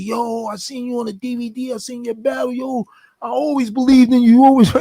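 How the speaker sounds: high-pitched imitation of an admirer; gushing fan. Ex-inmate at a temp job greets the rapper, imitated gushing. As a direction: play a fan hyping up the rapper. Voices fans who recognized him.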